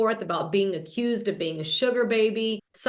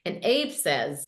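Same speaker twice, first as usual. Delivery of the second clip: ordinary talk, nothing marked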